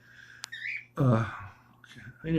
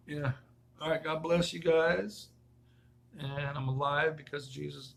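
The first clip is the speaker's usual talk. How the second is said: ordinary delivery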